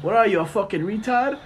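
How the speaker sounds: Mark Wahlberg. Acted. Co-host testing his impression on his friend, mock Boston accent. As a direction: put on a challenging Boston accent, dropping the r. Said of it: "is that a Boston accent".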